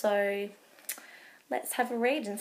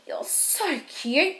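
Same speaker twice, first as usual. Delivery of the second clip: slow, higher squeaky coo; squirrel voice; 4 semitones higher, slower than usual. Acted. Squirrel doting on Baby Owl, slow higher coo.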